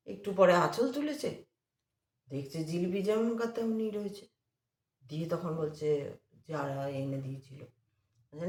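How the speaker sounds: ordinary delivery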